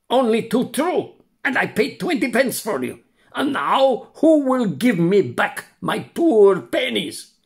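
put-on high, whiny stingy old man; grumbling miser, mourning his pennies; complaining old man. A narrator's reading. Purchaser complains.

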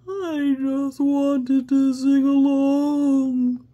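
loud, high, slow sobbing wail; dragon sobbing. Acted. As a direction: wail it through tears as the dragon. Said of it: "the dragon sobs".

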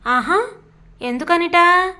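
playful mock-surprised jab. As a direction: tease him, poke fun at his worry. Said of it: "అంది ఇందిర ఎగతాళిగా".

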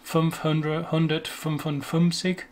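German number in an English accent; anglicised German with plain English vowels. Shown as a demonstration. English speaker attempting the German number, in an English accent. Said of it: "say with an English accent".

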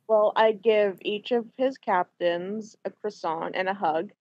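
usual speaking voice

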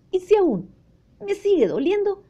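put-on high-pitched anxious child; worried boy. Boy asks mother about pain at dentist, worried boy. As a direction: push it high, like a fretting little boy.

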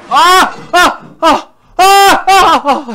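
high loud repeated yells; frustrated screams. Real, not acted. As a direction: shout out each burst of frustration.

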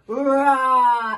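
playful loud tiger roar; short loud mock roar. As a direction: roar like a friendly tiger for a child. Reading animal book to child.

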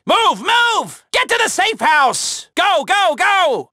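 shouted escape orders under fire; urgent shouting. Acted. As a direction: bark them out of there fast. Frantic orders during a getaway.

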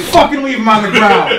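high-pitched mock outburst; imitated angry order. Storyteller acts his friend refusing help with donuts, mock angry order.

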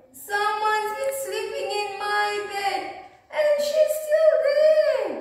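put-on crying baby bear. A narrator's reading. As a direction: squeal it like a tiny upset cub. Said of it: "cried Baby Bear".

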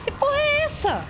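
yelling in alarm at a monster; panicked shout. Real, not acted.

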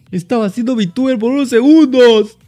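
high, loud, fast VTuber voice; cute anime streamer. Pretend. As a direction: play the cutesy anime girl.

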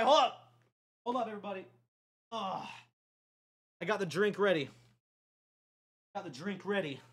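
ordinary talk

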